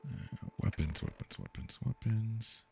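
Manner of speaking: half-whispered, repeating under breath; muttering to self. Real, not acted. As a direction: mumble it while searching. Muttering to self while searching.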